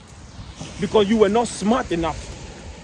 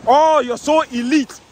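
1 mocking; 4 semitones higher, 5 dB louder, slower than usual. Preacher mocking the elite.

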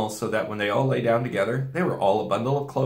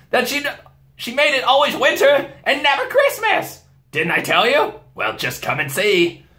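high, loud triumphant beaver; gleeful told-you-so; excited beaver; 7 dB louder than usual. A narrator's reading. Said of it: "answered Mister Beaver".

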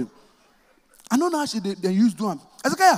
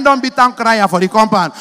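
normal talking voice

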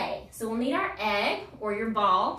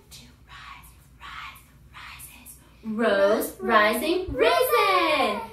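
whisper to loud build; 6 dB louder, much slower than usual. Shown as a demonstration. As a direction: begin whispering, finish shouting. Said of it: "start low and we're gonna whisper ... get louder".

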